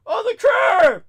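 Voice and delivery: very high yelped order; mock hysterical shout. Crying driver ordering passenger to the curb, mock hysterical shout. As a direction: squeal it out like a panicked man.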